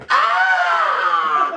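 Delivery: sudden high pained scream; burning scream. Retold or imitated. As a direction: scream as if set on fire. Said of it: "You'll be like".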